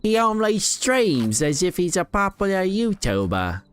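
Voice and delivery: high, mock-formal British accent; British accent mocking himself; put-on British accent. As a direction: keep the hammed-up British narrator going. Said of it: "I gave you that accent".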